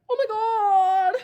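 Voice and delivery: high, slow, drawn-out wail; stretched-out high cry of shock; horrified disbelief. Retold or imitated. Wailing reaction to shocking news.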